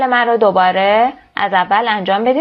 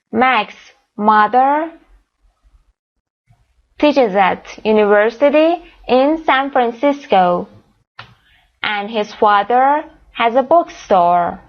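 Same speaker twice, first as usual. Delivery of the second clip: slow dictation; much slower than usual. Shown as a demonstration. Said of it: "teacher dictates answer slowly".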